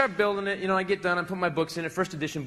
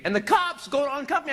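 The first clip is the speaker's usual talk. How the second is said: ordinary delivery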